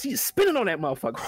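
ordinary talk

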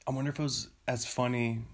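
usual speaking voice

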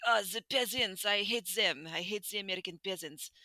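put-on snooty rich-guy accent sliding into Russian; mock Russian-tinged accent, scornful of peasants; mock foreign accent turning Russian. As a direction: do a hammed-up European accent that drifts Russian. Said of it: "it went a little Russian there".

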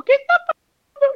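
high squeaky voice for Pepa's panicked question; confused friend. Retold or imitated. Friend bursting from the cinema asking, in a high squeaky voice.

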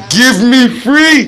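slow, high begging cry; hammed-up freedom cry; Amistad plea. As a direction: plead it slowly in the courtroom. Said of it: "I sit up in the court like".

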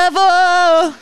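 hammed-up emotional singer calling out; mock singer's cry. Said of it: "Ele começa". (imitar o cantor a gritar)

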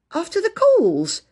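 slow, high-pitched incredulous echo; questioning repeat. A narrator's reading. Child echoing the invitation, incredulous. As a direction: repeat it high and drawn out, in disbelief. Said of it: "repeated Phyllis".

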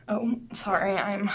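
ordinary talk, nothing marked

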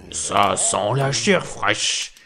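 slow booming giant voice; giant shouting. A narrator's reading. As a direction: boom it out like a hungry giant. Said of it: "en criant".

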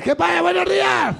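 gritando alegre, muy agudo, entre risas; saludo a gritos. Retold or imitated. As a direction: saluda a voces como un loco. Saludo exagerado a vecinos. (imitando un saludo a gritos entre risas)

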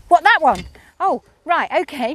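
mildly panicked reaction, sinking into okay; retold panic. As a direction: act out a rider's sudden nerves. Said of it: "the panic in my voice was".

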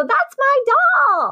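put-on high, slow childlike protest; whiny child. Host mocks herself as a child at the estate sale, whiny. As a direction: wail it like a kid losing her doll.

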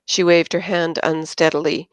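ordinary delivery, nothing marked

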